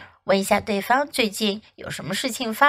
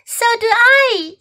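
much higher, chirpy little bird; tiny robin; 12 semitones higher, 5 dB louder than usual. Acted. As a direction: squeak it like a robin. Robin speaks, very high.

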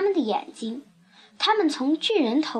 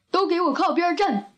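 higher, bossy storybook elephant; lightly voiced bossy elephant; elephant character; 4 semitones higher than usual. A narrator's reading. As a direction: order them aside as an elephant. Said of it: "大象说".

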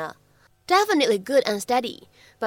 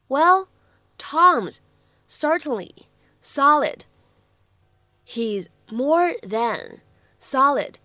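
"He's more than solid." said very slowly, word by word; careful slow model reading; slow drill reading; 2 semitones higher, much slower than usual. Shown as a demonstration. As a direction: read it slowly for the students to copy. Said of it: "注意哪些发音技巧".

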